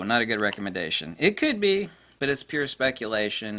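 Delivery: ordinary delivery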